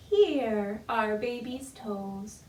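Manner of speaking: normal talking voice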